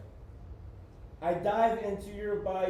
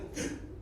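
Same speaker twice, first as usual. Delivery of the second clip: quick unvoiced gasp; startled gasp; sharp gasp; 6 dB quieter than usual. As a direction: suck in a gasp. Sudden gasp in poem.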